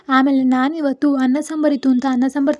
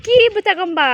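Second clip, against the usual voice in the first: higher-pitched urgent demand on the phone; calling out; 9 semitones higher, 3 dB louder than usual. Real, not acted. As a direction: call out for the key to be brought. Phone call demanding the key. (ಕೂಗಿ ಕರೆಯುವ ಧ್ವನಿ)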